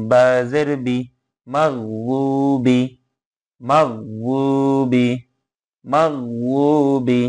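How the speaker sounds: measured, intoned letter-by-letter recitation; teacher's Arabic recitation for learners to follow; Quran spelling drill. Shown as a demonstration. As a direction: spell out each syllable, then join it in a lilting chant.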